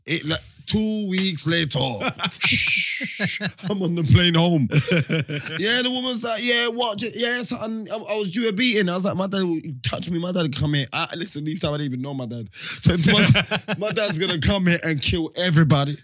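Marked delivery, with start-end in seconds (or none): none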